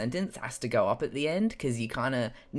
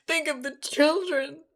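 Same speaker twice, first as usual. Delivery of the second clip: much higher, louder hammed-up pleading; mock shrill plea; 3 dB louder than usual. Shown as a demonstration. Demoing a voice for the viewers, mock shrill plea. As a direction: wail it like a scandalised busybody. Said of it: "Like that".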